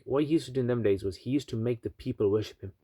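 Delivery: ordinary delivery, nothing marked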